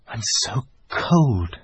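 put-on small girl's voice, slow and cold; a lightly voiced young girl, chilled; Lucy shivering. A narrator's reading. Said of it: "said Lucy".

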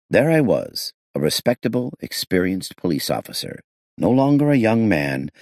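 plain reading voice

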